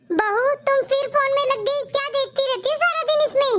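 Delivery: scolding. Acted. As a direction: tell her off for the phone again. Mother-in-law scolds phone-addicted bahu. (झुंझलाकर डांटती सास)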